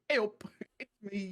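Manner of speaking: broad Emmerdale greeting through a laugh; Yorkshire accent. Retold or imitated. Returning to the Emmerdale joke, mock Yorkshire accent. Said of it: "I just want to go".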